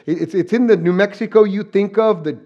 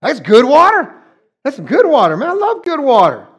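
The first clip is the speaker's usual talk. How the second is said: excited; 5 semitones higher, 5 dB louder than usual. Real, not acted. Raving about hometown tap water.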